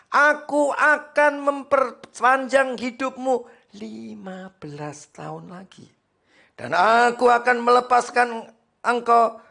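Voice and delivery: loud, high scripture reading; full-force preaching. Acted. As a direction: proclaim it to the congregation. (membaca ayat dengan suara khotbah lantang)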